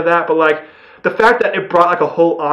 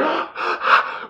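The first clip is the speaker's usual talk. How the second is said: sharp high breathless gasp; shocked gasp; 12 semitones higher, 4 dB quieter than usual. Retold or imitated. Reviewer spotting a surprise actor, gasps in shock. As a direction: suck in air in shock.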